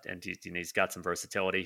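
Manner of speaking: normal talking voice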